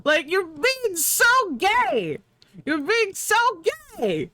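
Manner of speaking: mocking high squeal. Heckling the villain Lex, high mocking squeal. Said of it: "And I'm like".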